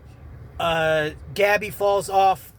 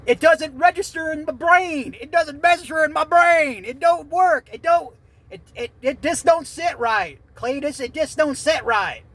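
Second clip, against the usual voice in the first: hillbilly voice; 8 semitones higher, 4 dB louder than usual. Pretend. Mocking the ending to Cletus in a high loud hillbilly voice.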